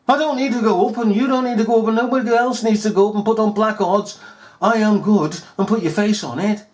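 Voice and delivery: high, fast, loud sneering mimicry of the police; a mocking impersonation of a smug official; mocking quote. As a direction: parrot their self-promotion with scorn. Mocking the police's self-promotion.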